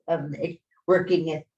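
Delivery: ordinary talk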